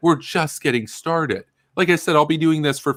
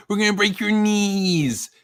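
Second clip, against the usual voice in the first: much higher, slow put-on mafia voice; mafia boss threat; 9 semitones higher than usual. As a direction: ham up a mobster's threat, slowly. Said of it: "He's like".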